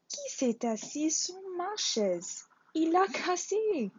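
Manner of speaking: high little bear voice, near tears; a tiny bear upset, pitched high; Bébé Ours. A narrator's reading. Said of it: "il se mit à pleurer". (Bébé Ours, voix aiguë au bord des larmes)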